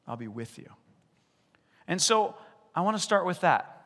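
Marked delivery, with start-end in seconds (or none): 0.0-0.7 quiet, gentle voice of God; God soothing a scared disciple; soft reply as God. Retold or imitated. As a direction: hush it down, reassuring. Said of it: "He goes".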